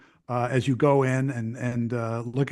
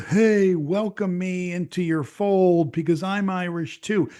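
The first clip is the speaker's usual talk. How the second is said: mock desperate American; 4 semitones higher than usual. American begging Irish locals for acceptance, mock desperate. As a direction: play a tourist begging to belong. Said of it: "come across as this desperate American".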